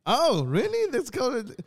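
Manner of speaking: high, loud mock amazement; acted surprise. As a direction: replay a sudden delighted realisation. Acting out reaction to friend's explanation.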